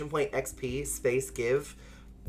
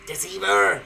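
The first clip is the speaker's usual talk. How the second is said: playful accusing cry; teasing betrayal; mock outrage; 7 semitones higher, 4 dB louder than usual. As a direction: call chat a traitor, hammed up.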